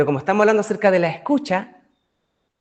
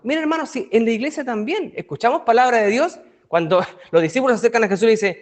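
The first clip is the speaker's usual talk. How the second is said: ordinary delivery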